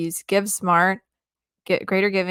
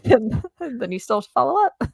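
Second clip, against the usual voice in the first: laughing; 5 semitones higher than usual. Real, not acted. Laughing over useless TBD guest answers.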